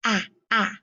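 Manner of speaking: "Ah, ah" as the vowel of ask, twice apart; short A sound. Shown as a demonstration. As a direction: isolate short A in "Ah, ah". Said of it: "What do I hear?".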